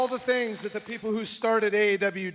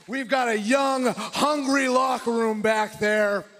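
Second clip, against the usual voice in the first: fired-up shouting; 8 semitones higher, slower than usual. Real, not acted. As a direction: roar it to the arena. Fired-up wrestling promo.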